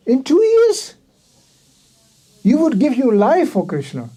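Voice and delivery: incredulous exclamation. Retold or imitated. Newcomer astounded by his peers' devotion, high incredulous cry. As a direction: throw the first words up high in amazement.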